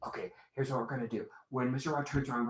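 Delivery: quick, very soft plotting; conspiratorial aside. Acted. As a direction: let the kids in on a secret plan. Puppet plotting secretly with the kids.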